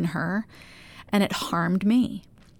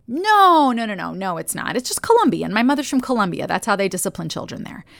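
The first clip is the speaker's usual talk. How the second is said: immediate countering; 2 semitones higher than usual. Retold or imitated. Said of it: "And I immediately countered".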